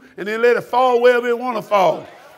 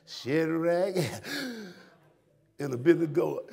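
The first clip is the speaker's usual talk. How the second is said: slow, low, sing-song mock hooping; hooper preacher sound; 6 semitones lower, 7 dB quieter, slower than usual. Mocking preachers who sell a sound, a hint of hooping. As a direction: put a lazy musical sound on the names. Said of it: "I put a little sound to my voice".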